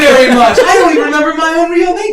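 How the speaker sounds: high, loud, rattled-off yelling; rowdy crowd member. Acted. Crowd member to the others, high rowdy shouting. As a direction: babble loudly over the cheering crowd. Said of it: "the whole crowd is screaming".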